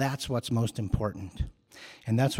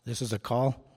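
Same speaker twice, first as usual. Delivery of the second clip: normal talking voice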